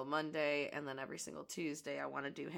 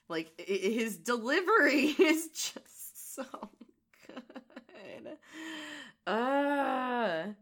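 laughing; 8 semitones higher, 10 dB louder, much slower than usual. Real, not acted. Laughing about villain's delivery.

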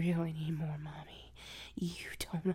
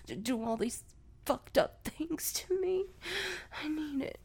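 ordinary talk

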